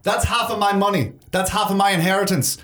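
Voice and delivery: high-pitched, indignant protest; irate cave woman. Acted. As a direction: argue for your money in an angry character voice. Voices Fiona protesting over her inheritance.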